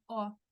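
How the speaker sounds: O with acute accent, said open like "aw". Shown as a demonstration. Teacher explains the tilde, open O. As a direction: open the mouth on O. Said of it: "con la tilde".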